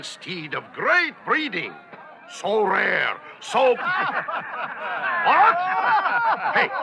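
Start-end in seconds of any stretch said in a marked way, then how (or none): 3.8-5.2 high laugh. Acted. Auctioneer laughing. 5.2-6.7 startled what. Acted. Auctioneer startled.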